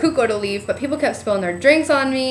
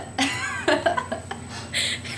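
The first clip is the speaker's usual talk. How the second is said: laughing; 5 semitones higher, 8 dB quieter than usual. Real, not acted. Laughing while retelling dancing.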